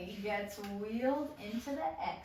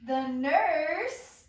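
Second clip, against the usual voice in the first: much louder, booming entrance call; big announcement; 4 semitones higher, 11 dB louder than usual. Acted. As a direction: announce her like a herald. Narrator announces the nurse's entrance.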